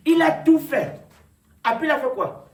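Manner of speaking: loud, high preaching, punched phrase by phrase; prédication enflammée. Real, not acted. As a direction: proclaim it forcefully to the congregation. Preaches at full force. (prédication à pleine voix)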